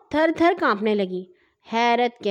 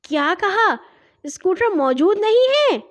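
high, louder shocked shout; 7 semitones higher, 5 dB louder than usual. A narrator's reading. Narrator shouting on hearing the scooter is gone. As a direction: yell it out in disbelief. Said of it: "میں نے چلا کر کہا".

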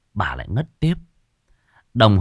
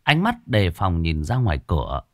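usual speaking voice

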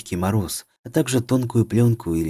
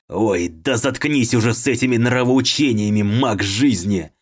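louder, higher bitter snarl; monstrous villain voice; 3 semitones higher, 4 dB louder than usual. A narrator's reading. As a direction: sneer with hatred. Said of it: "не унимался парень".

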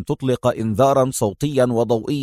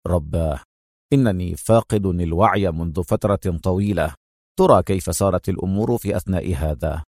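low man's voice, concerned; 7 semitones lower than usual. A narrator's reading. Man checks his watch, low and concerned. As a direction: mutter it with concern.

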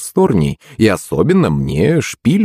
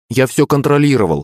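higher, self-assured smirk; 6 semitones higher than usual. A narrator's reading. Son reassures mother he had control, smirking. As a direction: grin through the words. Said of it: "ухмыльнулся я".

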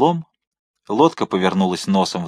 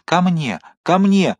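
rising, higher cry for help; 7 semitones higher, slower than usual. Acted. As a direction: call out desperately, climbing higher each time. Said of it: "слабо крикнул Мордаунд". (отчаянный крик о помощи, всё выше)

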